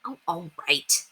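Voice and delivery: a grudging, quiet oh all right; huffy girl. A narrator's reading. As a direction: give in with a huff. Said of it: "flounced down the driveway".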